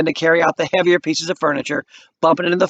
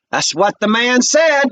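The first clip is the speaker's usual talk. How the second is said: higher, very slow and pointed; proud Benita; 8 semitones higher, 3 dB louder, slower than usual. A narrator's reading. Benita backing her brother, slow and pointed. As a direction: let it land with pride.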